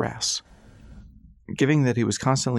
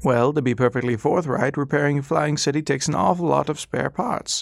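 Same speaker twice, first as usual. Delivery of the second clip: put-on higher, courteous old gentleman; patient, polite, in no hurry; old doctor; 5 semitones higher than usual. Old doctor to silent Ras, high and courteous.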